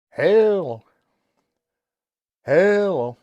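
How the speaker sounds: 2 a loud, drawn-out hello into the mic; 3 call into the mic like testing a radio; 1 loud mic check. Real, not acted. 4 keying the CB mic to check watts, loud call.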